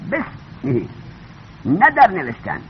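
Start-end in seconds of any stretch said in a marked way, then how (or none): 0.1-0.8 softer "بسمه" with به fused to the s; hamza not pronounced. Shown as a demonstration. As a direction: say "بسمه" as one word, bes-mehi. («بسمه» بدون همزه)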